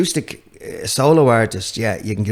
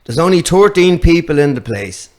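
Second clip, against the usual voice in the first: put-on raised, louder Tipperary accent; 5 semitones higher, 5 dB louder than usual. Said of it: "sorry about the accent".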